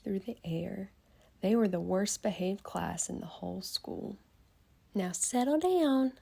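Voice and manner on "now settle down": lightly sweetened, higher coaxing voice; sweet teacher voice. Acted. Teacher sweetly asking unruly kids to settle down, lightly sweet. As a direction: coax the class gently, sweetly. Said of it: "said Miss Nelson in a sweet voice".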